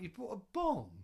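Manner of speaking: retold disbelief. Telling a friend how he reacted to the bong, disbelieving. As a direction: ask it like you can't believe it.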